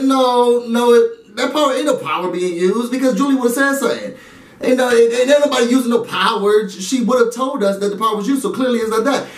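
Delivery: mock his exasperated denial; lightly voiced frustrated houseguest; mock exasperated Tyler. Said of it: "Tyler's like".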